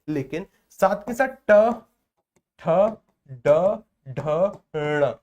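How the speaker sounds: ट ठ ड ढ ण spoken slowly one by one, each sounded clearly; articulating retroflex letters. Shown as a demonstration. Teacher demonstrating ट ठ ड ढ ण to students, slow and deliberate. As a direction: sound out ट ठ ड ढ ण with the tongue curled back. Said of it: "ये जो मूर्धन्य वर्ण होते हैं".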